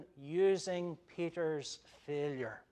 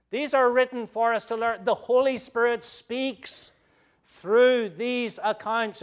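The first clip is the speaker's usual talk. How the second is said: full-force preaching; 4 semitones higher, 12 dB louder than usual. Acted. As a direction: drive the point home from the pulpit.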